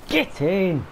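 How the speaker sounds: high, loud Yorkshire "Get in!" with broad flat vowels; excited Yorkshire goal cheer. Real, not acted. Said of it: "I need to slap the Yorkshire out of myself".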